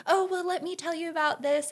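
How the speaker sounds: high-pitched, eager imitation; mildly acted excitement. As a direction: imitate someone bursting to share. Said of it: "just, like, get so excited".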